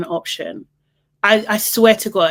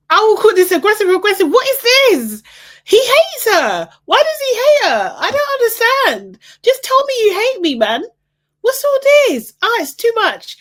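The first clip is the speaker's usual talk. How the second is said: very high-pitched, squealing rant; exasperated outburst; 4 dB louder than usual. Real, not acted. Viewer ranting that he hates her, exasperated squeal. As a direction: wail it in baffled outrage, high and loud.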